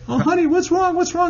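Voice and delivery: high-pitched imitation of a concerned mom; put-on worried mother; mother's voice. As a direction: fuss over a crying child in a high mom voice. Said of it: "And she's like".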